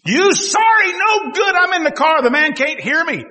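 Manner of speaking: high, loud hammed-up yelling at another driver; mock angry shouting. Preacher imitating himself yelling at a driver, mock furious shout. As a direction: act out shouting in the car. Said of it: "to shout at someone".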